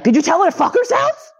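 high, incredulous squeak of outrage; shocked disbelief. Retold or imitated. To mom about the teacher, a shocked high-pitched disbelieving question. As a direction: retell your stunned disbelief, way up high.

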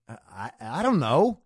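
acted hesitant stammering reply; put-on unsure answer; flustered stammer. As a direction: stumble over the first word, caught out. Flustered camper put on the spot.

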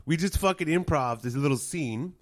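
ordinary delivery

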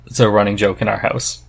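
normal talking voice

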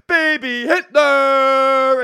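very slow, high, loud drawn-out ring announcer call; hype announcer. Pretend. Joking any words work if hyped, slow loud announcer call. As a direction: stretch it like a boxing intro. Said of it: "I could have been like".

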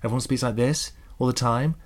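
"this" and "time" lifted at the end like questions; rising questioning tune on "like this all the time"; upward inflection. Shown as a demonstration. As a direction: mimic uptalk, tipping "time" up as if asking. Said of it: "she did the upward inflection".

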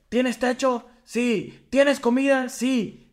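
playing the father yelling his questions; retold yelling father. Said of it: "le empieza a gritar". (grito imitado del padre, más agudo)

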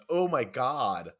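usual speaking voice

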